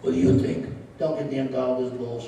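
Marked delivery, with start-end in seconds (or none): 0.0-0.7 puppet voice. Acted. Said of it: "Let's change voices".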